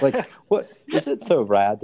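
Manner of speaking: high laughing burst. Real, not acted. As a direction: laugh through the words. Laughing mid-sarcasm.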